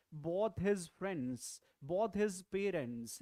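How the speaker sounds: slow, careful example reading; slow model phrase. Shown as a demonstration. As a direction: say each word slowly for the class. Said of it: "if you speak like".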